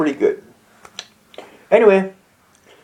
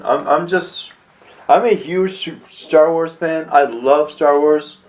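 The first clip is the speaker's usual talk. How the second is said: normal talking voice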